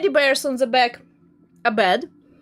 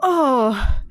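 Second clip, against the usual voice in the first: ordinary delivery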